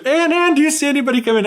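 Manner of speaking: fast, high mock call; urgent calling. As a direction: imitate a hurried call to a sister. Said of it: "turns back to her sister".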